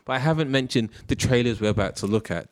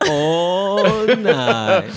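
laughing through the words; 9 semitones higher, 4 dB louder than usual. Real, not acted. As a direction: giggle through it. Laughing at the teasing.

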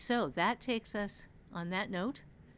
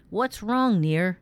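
loud, raised call across the dorm; louder voice; 13 dB louder, slower than usual. A narrator's reading. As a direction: call out over the others. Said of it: "Rokrus's voice was louder than the rest".

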